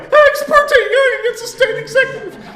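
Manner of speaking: very high, loud, laughing squeak; squeaky nervous answer. Retold or imitated. The student answering the professor in a high, squeaky voice. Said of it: "My voice sounded more like".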